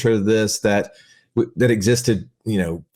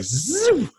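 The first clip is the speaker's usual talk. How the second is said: high whooshing zoom noise; imitated zoom; 14 semitones higher than usual. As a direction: shoot out a quick high zoom like a dog bolting. Imitates dog shooting off.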